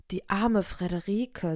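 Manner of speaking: plain reading voice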